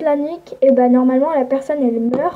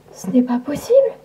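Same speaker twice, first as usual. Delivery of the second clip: incrédule chuchoté. Acted.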